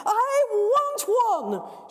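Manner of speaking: high, loud, very slow shriek. Acted. Girl shrieking at the dog for a fur coat, loud high shriek. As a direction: shriek it like a tantrum. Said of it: "shrieks the girl".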